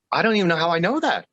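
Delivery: high, quick, astonished; amazed. Retold or imitated. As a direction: blurt it out in wonder. Wife's sudden realization, quoted.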